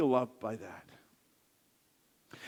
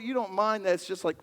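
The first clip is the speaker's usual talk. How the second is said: ordinary talk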